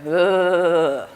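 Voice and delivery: loud, long drawn-out "ugh"; mock animal call; imitated animal groan. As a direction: stretch out a mimicked guttural noise. Repeats daughter's noise for lovers communicating.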